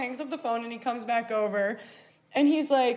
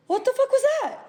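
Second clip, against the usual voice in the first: very high, loud mock disbelief; incredulous; 13 semitones higher, 5 dB louder, faster than usual. Confronting him back at the table, incredulous. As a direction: squeal the question in astonishment.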